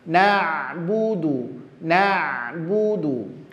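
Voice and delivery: "Na'budu" slow, both u vowels pure; dhammah jelas. Shown as a demonstration. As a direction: draw out "Na'budu" with thin na and clear oo. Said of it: "sama-sama dhammah". ("Na'budu" pelan, bu dan du berbunyi u)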